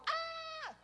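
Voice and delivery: retold scream of shock at a sting; mock scream. Man stung by a yellow jacket, mock scream. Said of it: "I screamed".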